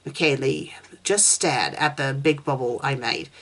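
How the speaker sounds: ordinary delivery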